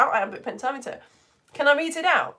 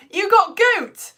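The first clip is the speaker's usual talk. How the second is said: delighted laugh; 11 semitones higher, 6 dB louder, much slower than usual. Real, not acted. Excited reaction to goat.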